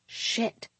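whispered under the breath; whispered curse. Acted. Cursing to herself caught out.

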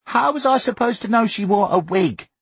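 put-on very high, indignant boy's voice; protesting boy. A narrator's reading. Son defending himself over the wig, protesting. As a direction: protest in a high childish pitch.

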